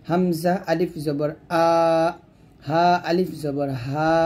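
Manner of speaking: slow spelling, আ and হা from the throat, held long; throat-start madd. Shown as a demonstration. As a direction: stretch আ and হা from the throat. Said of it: "গলা শুরু থেকে উচ্চারণ হবে".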